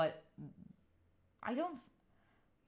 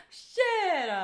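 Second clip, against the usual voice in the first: ordinary talk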